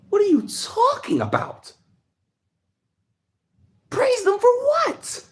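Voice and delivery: can't believe what he's hearing, voice shooting up; high, slow what-are-you-talking-about; incredulous outrage. Real, not acted. Outraged at praising the soldiers.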